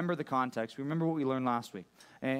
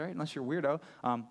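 ordinary talk

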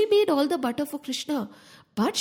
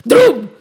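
loud crash; 15 dB louder than usual. Acted. Door bursting open.

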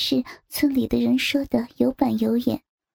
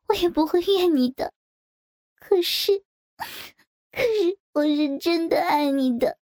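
choked sobbing; 2 semitones higher than usual. Acted. Lover confessing everything to his husband, choking on sobs. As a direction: confess it through rising tears. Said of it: "声音被哭泣的呜咽全塞住了".

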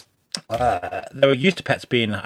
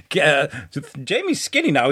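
ordinary delivery

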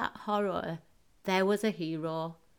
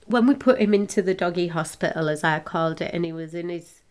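ordinary talk, nothing marked

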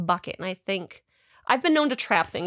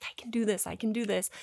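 quiet self pep talk, under the breath; softly talking yourself into it; 9 dB quieter than usual. Retold or imitated. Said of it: "talking myself up into this".

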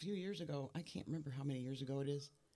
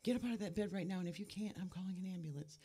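higher-pitched urgent order, volume held back; retold upset yelling; 6 semitones higher than usual. Son ordering his sick mother out of bed, mock yelling. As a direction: imitate an upset son's yell without really shouting. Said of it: "starts yelling".

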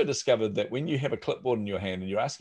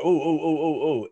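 impressed oohs; 6 semitones higher, 5 dB louder than usual. Retold or imitated. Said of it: "everyone's like".

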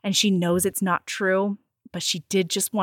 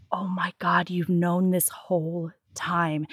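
slow, whispery disbelief; hushed realization; 4 semitones lower, 6 dB quieter, slower than usual. Retold or imitated. Betrayed woman realizing he always knew, hushed. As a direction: let it sink in, barely voiced.

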